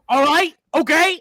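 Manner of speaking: loud, high mock yelp; startled backing down. Imitating a tourist yelled at by a local, high loud yelp. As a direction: blurt it loudly, imitating someone told off. Tourist answers the angry lady.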